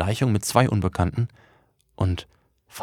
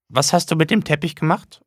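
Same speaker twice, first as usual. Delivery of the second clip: high, eager prying mother; high prying mother's voice; nosy mother; 9 semitones higher, 4 dB louder than usual. Acted. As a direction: coax him to open it. Mother questions son.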